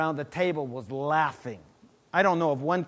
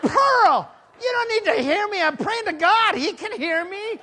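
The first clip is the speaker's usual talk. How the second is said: high, laughing, teasing reply; laughing retort; 5 dB louder than usual. Retold or imitated. Laughing at Pearl who cannot hear his prayer, high and amused.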